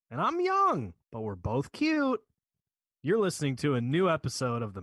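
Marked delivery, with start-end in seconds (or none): 0.1-2.2 squeaky high voice. Pretend. Silly podcast intro voices.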